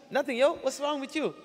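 put-on high, offhand kid brushing it off; casual friend. Friend dismissing the question, casual. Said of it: "I would ask my friend".

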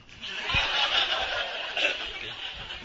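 breathy burst of laughter at his own joke; laughing. Real, not acted. Preacher laughing at his own story, unvoiced laughter.

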